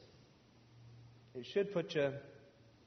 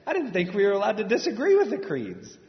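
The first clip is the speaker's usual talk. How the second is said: much higher, louder, laughing through it; laughing disbelief; 10 semitones higher, 10 dB louder than usual. Real, not acted. Admitting to the congregation, laughing disbelief. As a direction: laugh at how naive you were. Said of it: "laughing at his own naivety".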